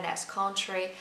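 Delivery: normal talking voice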